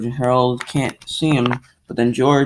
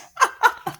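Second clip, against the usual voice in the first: very high silly giggle; squeaky giggle. Pretend. After squeaking the silly name, high giggle. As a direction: giggle in a tiny cartoon squeak.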